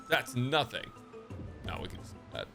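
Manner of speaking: ordinary delivery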